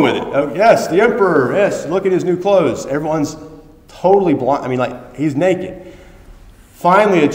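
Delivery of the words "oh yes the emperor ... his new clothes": mock admiration. Crowd faking admiration.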